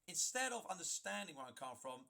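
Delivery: ordinary talk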